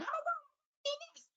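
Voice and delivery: tiny high squeal, softened; impulsive kid brain. Acted. Child's impulsive brain protesting, in a soft high mock squeal. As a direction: squeak it out as a small mock tantrum. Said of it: "that impulsive brain going".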